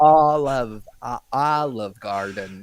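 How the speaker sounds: slow, laboured attempt at an American accent; botched American accent. British kids attempting Olive Garden, botched American accent. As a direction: imitate Brits groping through an American accent. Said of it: "try to say Olive Garden in an American accent".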